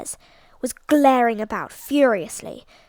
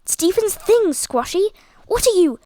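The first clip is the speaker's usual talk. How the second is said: higher, louder cry of outrage; outraged scolding; 6 semitones higher, 4 dB louder than usual. A narrator's reading. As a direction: still scolding the dog, indignant. Bertie still scolding the dog.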